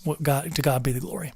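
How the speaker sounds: ordinary talk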